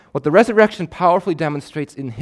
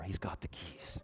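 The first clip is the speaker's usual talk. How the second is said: hushed; 6 semitones lower, 19 dB quieter than usual. Acted. Echoing Satan's line softly.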